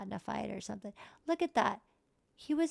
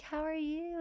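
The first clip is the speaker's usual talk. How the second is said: high character voice; 8 semitones higher than usual. Pretend. Voicing sims greeting brother.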